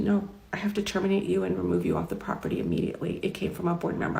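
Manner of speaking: hushed, reluctant boss's voice; softly delivered bad news; subdued retold firing.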